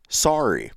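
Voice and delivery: "sorry" with an open ah, like "sah-ree"; American pronunciation. Shown as a demonstration. American showing Canadians the word, "sorry" said with ah. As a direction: say "sorry" the American way, first vowel as ah.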